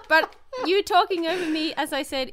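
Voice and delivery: normal talking voice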